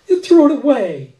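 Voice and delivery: put-on high, loud accusing child voice; a girl shouting at the man; outraged little girl. A narrator's reading.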